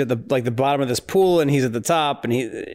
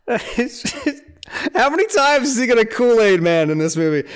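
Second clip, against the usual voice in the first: laughing; 10 semitones higher, 3 dB louder than usual. Real, not acted. Laughing at Michael bursting through walls.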